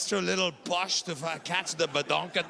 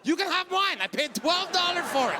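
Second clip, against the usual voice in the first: gleeful offer; 10 semitones higher, 4 dB louder than usual. Retold or imitated.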